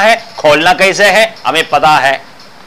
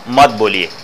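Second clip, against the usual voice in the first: calmly; 8 semitones lower than usual. Retold or imitated. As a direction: settle the disciple down gently. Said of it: "गुरु शांत होकर के बोला".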